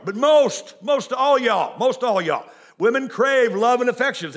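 loud, high sermon cry; preaching at full force. Acted. Preacher shouting to the church about wives. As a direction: build it up and shout it out to the pews.

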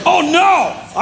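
high, comic cry of dread; mock dread. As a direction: send it way up in pretend horror. Dreads facing Miss Robin at home.

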